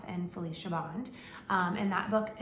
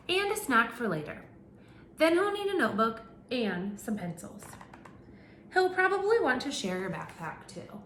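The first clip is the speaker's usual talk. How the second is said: animated picture-book narration; reading to children; 3 semitones higher than usual. Acted. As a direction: read it out to a class of little kids. Reads picture book to class.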